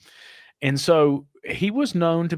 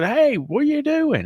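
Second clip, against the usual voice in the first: bright greeting, pitched much higher; a chirpy, much higher "hey"; cheery greeting; 12 semitones higher than usual. Retold or imitated. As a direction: call out a friendly hello across the shop.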